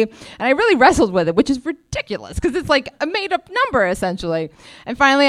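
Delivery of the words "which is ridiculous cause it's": usual speaking voice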